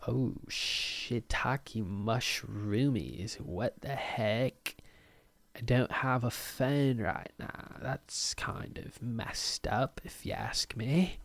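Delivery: soft, drawn-out disbelief; mock quiet dismay. Podcaster reliving reaching for his missing phone, slow hushed mock dismay. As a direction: say it slowly and softly, as if stunned.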